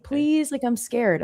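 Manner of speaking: put-on high small frightened child voice; imitating scared son. Son begging mom from the slide, high scared plea. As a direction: plead like a timid little kid. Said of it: "he's like".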